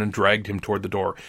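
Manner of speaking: ordinary delivery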